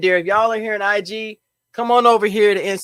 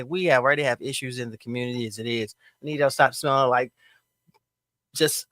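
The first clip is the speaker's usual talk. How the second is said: ordinary delivery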